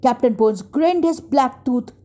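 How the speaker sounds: usual speaking voice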